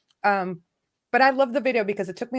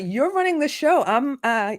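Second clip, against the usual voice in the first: ordinary delivery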